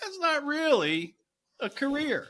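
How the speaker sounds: high, drawn-out mock scepticism; dismissive doubter. People looking at a DJ job and judging it, mock dismissive. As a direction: imitate doubters in a slow, high-pitched voice. Critics' dismissive remark, acted.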